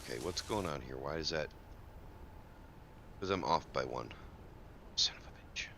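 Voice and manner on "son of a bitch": whispered curse. Real, not acted. Muttered frustration at being off.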